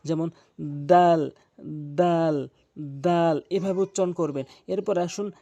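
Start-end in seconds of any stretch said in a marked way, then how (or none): 0.6-3.4 দাল said slowly and loudly, tongue tip at the tooth roots; dal demo. Shown as a demonstration. Teacher showing students the letter দাল. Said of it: "জিহ্বার আগাটি নিয়ে সামনে দুইটি দাঁতের গোড়া".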